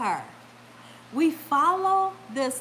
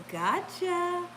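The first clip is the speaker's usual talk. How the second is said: higher, sly mock taunt; teasing gotcha; 4 semitones higher than usual. Husband's complaint about the lady looking back, mimicked teasing. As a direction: imitate a playful sneaky tease. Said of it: "She gonna do like this".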